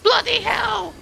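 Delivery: very high shrieked exclamation; shrieked curse. Real, not acted. Player reacts to the fight going badly, shrieking. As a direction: let it burst out high and startled. Startled outburst in the game.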